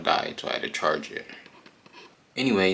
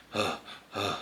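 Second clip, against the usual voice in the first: out of breath; 9 dB quieter than usual. Retold or imitated. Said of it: "'Cause he's out of breath".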